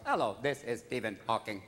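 low monotone speech-synthesizer voice; Stephen Hawking. Acted. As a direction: answer the phone like a talking computer. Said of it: "I once called Stephen Hawking's house".

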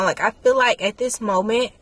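normal talking voice

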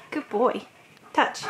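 ordinary talk, nothing marked

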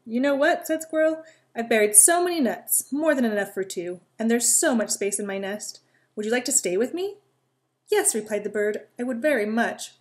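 lively read-aloud voice with little character voices; storytelling. A narrator's reading. Reading a squirrel and bird story to children, lively. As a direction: tell a bedtime story to young listeners.

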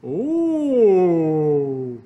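long, loud, swooping "oh"; touched father's "oh". A narrator's reading. Father is handed a cookie, gushing "oh". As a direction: draw out a big pleased "oh". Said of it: "said his father".

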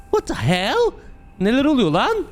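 high-pitched, startled disbelief; alarmed surprise. Real, not acted. Player finding blood in empty car, alarmed surprise. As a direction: exclaim high, stunned by the find.

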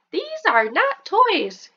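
high, slow, scolding mom; sing-song warning from a fussy mom; a parent telling kids off, lifted high; mom's scolding voice. Acted. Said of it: "your mom will say".

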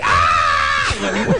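sudden mock yell, imitating. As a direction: bark out a fake angry yell. Host imitates ex-girlfriend yelling.

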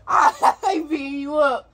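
talking through loud laughter, high; can barely get it out for laughing; cracking up watching the fight. Real, not acted. Laughing at friend being beaten.

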